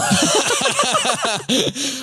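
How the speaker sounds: high giggle. Real, not acted. Laughs at his own joke.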